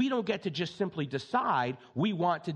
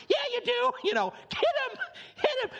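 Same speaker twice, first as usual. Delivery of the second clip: put-on squeaky high kid cheering on the fight. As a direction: egg on the TV fight like an excited child.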